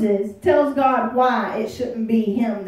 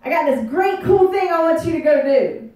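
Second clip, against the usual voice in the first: God calls out loud and high; booming God voice; 5 semitones higher, 6 dB louder than usual. Retold or imitated. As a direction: proclaim it like God with big news. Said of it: "God saying".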